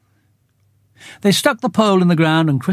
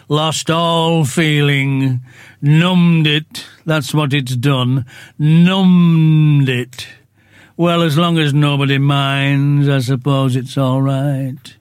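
slow, mournful donkey voice complaining; gloomy Eeyore; slower than usual. Acted. Eeyore grumbles to the others about his cold tail, slow and mournful. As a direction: drag each word out like a sad old donkey.

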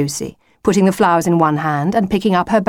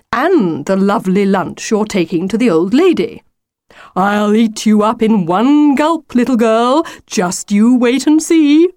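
wolf voice building higher and louder; impatient wolf, threatening; 4 semitones higher, 4 dB louder than usual. Acted. Wolf telling Lucy he will eat her, threatening. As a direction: lay out the plan, then draw out the threat.

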